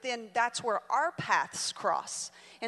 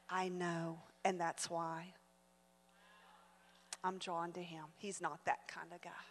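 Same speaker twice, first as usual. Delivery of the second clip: low, quiet and tender; soft confession; 6 semitones lower, 13 dB quieter than usual. Retold or imitated. Daughter quietly confiding in her mother about the boy. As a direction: confide it softly.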